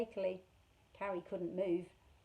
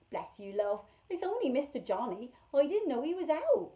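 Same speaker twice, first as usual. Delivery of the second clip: kindly country woman; 5 semitones higher, 6 dB louder than usual. Acted. Hepzibah reassuring frightened children.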